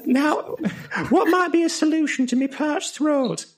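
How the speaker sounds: high, slow, strained teacher voice; high mock lecturer voice. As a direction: play a squeaky pompous educator. Constructivist voiced again.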